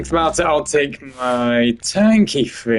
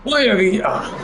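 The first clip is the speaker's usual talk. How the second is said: high laughing villain try; hammed-up villain voice breaking into a laugh; villain impression; 7 semitones higher than usual. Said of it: "I just can't do it".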